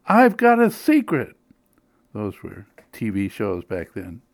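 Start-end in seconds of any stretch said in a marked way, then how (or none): 0.0-1.3 put-on high, loud eager old lady; third old lady voice. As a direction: pipe up like a thrilled elderly fan. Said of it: "a third voice added".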